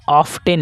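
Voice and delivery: "ऑफ टेन" with a clear hard T; often with T sounded. Shown as a demonstration. Teacher reading the word as written, T sounded in "ऑफ टेन". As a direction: pronounce the T in "ऑफ टेन". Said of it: "ऑफ टेन ना पढ़ के".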